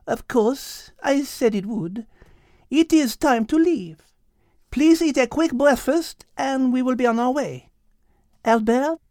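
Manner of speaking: put-on French-accented Liz. Liz explaining the rooster, French accent. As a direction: explain it with Gallic ease.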